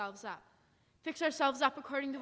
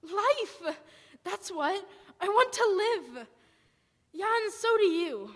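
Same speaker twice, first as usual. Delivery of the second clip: high, loud burst of will to live; fierce passion; 8 semitones higher, 6 dB louder, slower than usual. Acted. Soldier telling the wanderer why to live, high fierce outburst. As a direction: fling it out like a vow.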